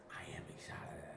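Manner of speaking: breathy whispered admission of excitement. Real, not acted. To chat, admitting excitement in a whisper. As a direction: hush it, like a secret thrill.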